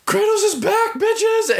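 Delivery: way up high, gleeful triumph; gleeful high yell. Retold or imitated. Gamer reliving the Chaos Blades return, squealing with glee. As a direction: squeal it like a thrilled fan.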